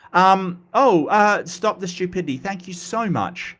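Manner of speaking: usual speaking voice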